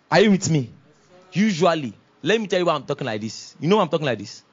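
usual speaking voice

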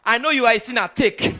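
loud, high, fervent preacher's cry; full-force preaching. Acted. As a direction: preach it out to the back row.

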